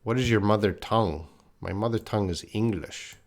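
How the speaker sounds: usual speaking voice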